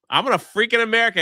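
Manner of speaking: fast, high, loud patriotic yell; fired-up shout. Retold or imitated. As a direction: shout it fast like a hyped fighter. Apollo quote, fired up.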